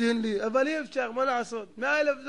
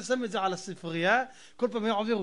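usual speaking voice

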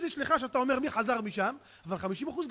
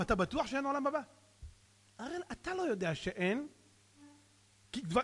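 ordinary talk, nothing marked

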